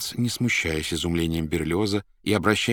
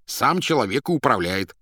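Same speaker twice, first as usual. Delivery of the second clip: loud, high, irritated retort; angry hasty answer; 6 semitones higher, 5 dB louder than usual. A narrator's reading. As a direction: snap back crossly. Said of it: "поспешил сердито ответить бездомный". (сердито и громко)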